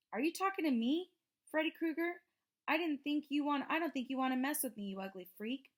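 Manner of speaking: high, loud sneering challenge; taunting bully. A narrator's reading. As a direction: goad him like a swaggering bully.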